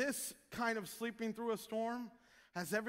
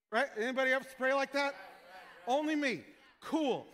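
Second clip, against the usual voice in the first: usual speaking voice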